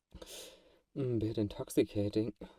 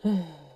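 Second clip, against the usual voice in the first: loud sigh; 7 semitones higher, 6 dB louder than usual. Acted. Sigh before the bite.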